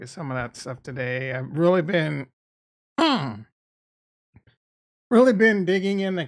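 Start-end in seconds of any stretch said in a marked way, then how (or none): 3.0-3.4 throat clearing. Real, not acted. Mid-sentence cough.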